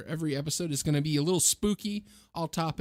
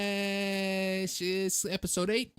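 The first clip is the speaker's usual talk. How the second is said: put-on light Chicago accent, pitched up; 5 semitones higher than usual. Co-host asked for a Chicago accent, does it. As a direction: order hot dogs like a Chicago local. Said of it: "give me your best Chicago accent".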